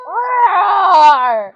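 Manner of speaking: huge roaring shout; shaking messy hair like a mane, full-voiced lion roar. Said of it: "It's like".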